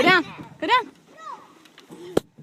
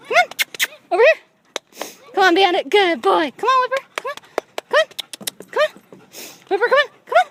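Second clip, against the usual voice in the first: higher, louder coaxing calls; talking to a dog; 9 semitones higher, 4 dB louder, much faster than usual. Real, not acted. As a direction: keep coaxing the dog up high.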